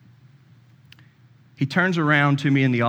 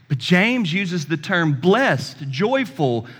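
much higher, slow, rising sermon cadence; full-force preaching; 10 semitones higher, much slower than usual. Acted. Pastor urging the congregation toward joy, preaching at full force. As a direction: lift the room with each word. Preaching climax on being blessed.

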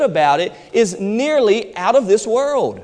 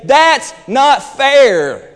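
whiny complaint; 5 semitones higher, 5 dB louder, much slower than usual. Retold or imitated. Preacher voicing a complaint.

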